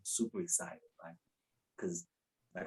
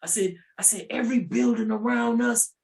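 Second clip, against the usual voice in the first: yelled rant; 10 semitones higher, 8 dB louder than usual. Retold or imitated. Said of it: "I yelled it out".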